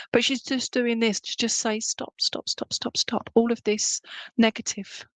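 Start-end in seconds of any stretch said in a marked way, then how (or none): 1.8-3.3 a breathy whispered plea, voice dropped away; whispered stop. Retold or imitated. Medium passing on a mum's plea, whispered. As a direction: medium relaying a spirit's urgent whisper to stop. Spirit urging stop, relayed again.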